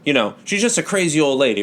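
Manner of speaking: ordinary delivery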